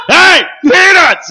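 loud, high, drawn-out yell; stadium vendor hawking. Retold or imitated. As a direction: bellow it right in their face. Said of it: "be like".

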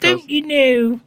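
high, slow, hammed-up showman voice; podcasting persona. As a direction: ham it up like a radio host. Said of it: "my podcasting persona".

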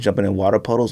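ordinary talk, nothing marked

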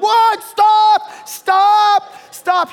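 high, loud frantic shouting; alarmed shout. Retold or imitated. Golf friends yelling at the pastor to stop, alarmed shout. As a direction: yell to halt him in panic. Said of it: "the rest of us are like".

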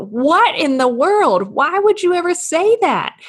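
high, loud, incredulous outburst; freaking out at what was just overheard; shocked disbelief. Acted. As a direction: act out appalled astonishment, pitch leaping up. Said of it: "we would probably freak out".

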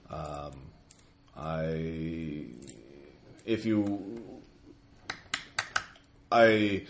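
low, measured, ominous; low undead lord voice. Acted. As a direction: speak like an ancient soul-eater. GM voices the soul devourer.

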